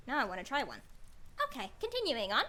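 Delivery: usual speaking voice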